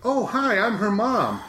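high, sing-song greeting from an overeager mother; chirpy mom voice. Retold or imitated. Mother introducing herself to her daughter's coworkers, high and gushing. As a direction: pitch it up and gush like an embarrassing mom. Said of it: "a million jokes like that".

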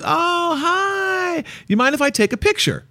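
high, gushing fan voice; imitating an eager lady fan. Husband retelling a fan's greeting, high and gushing. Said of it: "some lady comes up to me and she goes".

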